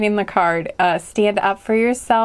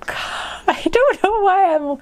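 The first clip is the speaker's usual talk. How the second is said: high, drawn-out words through real laughter; laughing; 10 semitones higher, slower than usual. Real, not acted. Tarot reader baffled by own giggles at the cards, laughing. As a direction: giggle helplessly while puzzled at yourself.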